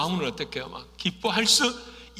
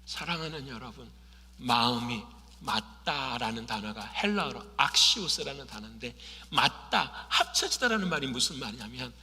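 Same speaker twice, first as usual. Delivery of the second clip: ordinary talk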